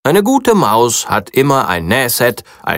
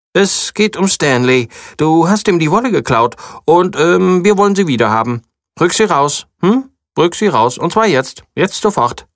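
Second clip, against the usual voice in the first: high, insistent mouse voice; a small mouse firmly demanding the wool back; 7 semitones higher than usual. Acted. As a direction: press the demand harder each time. Mouse confronts the farmer.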